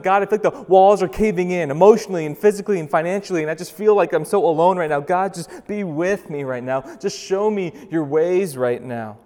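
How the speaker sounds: acted cry to God for help; desperate prayer. Believer feels walls caving in, pleading urgently. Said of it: "Those moments where you're like".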